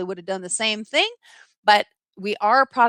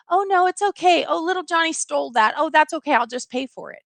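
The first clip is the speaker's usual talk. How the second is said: higher-pitched indulgent parent; mock soothing, high-pitched parent excusing a child; coddling parent; 6 semitones higher than usual. Parent excusing little Johnny's stealing, coddling. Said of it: "catering and coddling".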